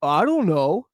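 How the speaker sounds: put-on 1 old man shrug. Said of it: "And he goes".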